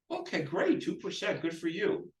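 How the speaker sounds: high-pitched, supportive approval; encouraging praise. Shown as a demonstration. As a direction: cheer the seller on in a high voice. Acting the agent praising the seller.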